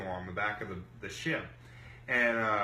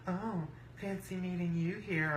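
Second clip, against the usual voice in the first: higher, softer mock greeting; mimicked rival women; 6 semitones higher, 5 dB quieter than usual. Rivals showing up at the table, high soft mimicry. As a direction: put on their higher, softer voice.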